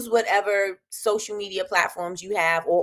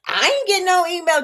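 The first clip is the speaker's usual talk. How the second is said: mock indignant; 9 semitones higher, 5 dB louder than usual. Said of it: "She was like".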